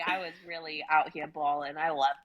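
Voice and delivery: normal talking voice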